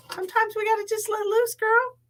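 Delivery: put-on squeaky high, playful girl-talk voice; sassy high voice. Cheering herself on to dance, in a squeaky high voice. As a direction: pitch it way up and tease.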